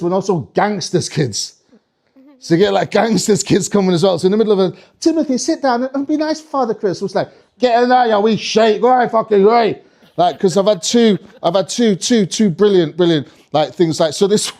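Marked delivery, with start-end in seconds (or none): 5.0-7.0 lightly voiced, high-pitched parent; a hint of a prim parent correcting a child; parent chiding child. Parent telling Timothy to behave before Santa, mildly chiding. 7.6-9.8 rough gangster parent. Acted. Gangster parent herding a kid into the grotto, rough.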